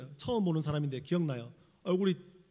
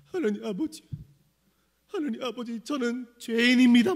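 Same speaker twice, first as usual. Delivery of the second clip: mock sobbing plea, higher, swelling loud and slow; imitated tearful prayer; 5 semitones higher, 6 dB louder than usual. Delinquent friend confessing to God in prayer, mock-crying. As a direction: wail it like a weeping penitent. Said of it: "울려고 해요. 울면서".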